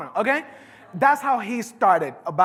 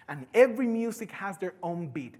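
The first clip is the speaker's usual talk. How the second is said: ordinary talk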